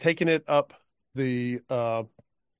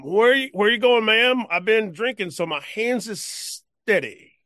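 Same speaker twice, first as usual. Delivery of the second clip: put-on drunk cowpoke; 8 semitones higher than usual. Drunk cowpoke offering the woman a ride, high and loose. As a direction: slur it like a tipsy old man.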